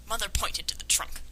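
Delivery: ordinary talk, nothing marked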